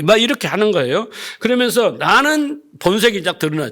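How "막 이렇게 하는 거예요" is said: ordinary delivery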